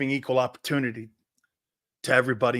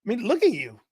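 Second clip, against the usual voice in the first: high, incredulous teasing voice; mock-amazed high squeal of ribbing; Jerome Brown; 7 semitones higher than usual. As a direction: imitate a locker-room joker, pitch jumping high. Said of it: "Jerome's like".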